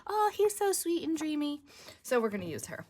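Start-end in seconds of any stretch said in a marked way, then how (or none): 0.1-1.6 high, swooning put-on voice; smitten woman. Crafter voices the pictured woman doting on her man, smitten. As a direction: gush dreamily in a much higher pitch. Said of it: "she's like".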